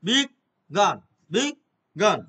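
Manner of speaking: "বিগ গান" said as two separate parts, with a break between "বিগ" and "গান"; "বিগ গান" split into two sounded chunks; spelled by sound. Shown as a demonstration. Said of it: "এইটা আমি উচ্চারণ অনুযায়ী".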